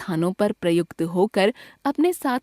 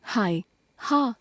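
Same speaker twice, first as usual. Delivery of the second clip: grieving sigh; 3 dB quieter, much slower than usual. Shown as a demonstration. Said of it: "शोक सूचक".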